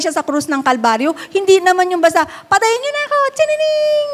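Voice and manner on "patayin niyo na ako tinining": high, careless sing-song mock voice; mock casual martyr. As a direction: toss it off like it means nothing. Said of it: "Ganyan, hindi". (pabirong boses na parang walang pakialam)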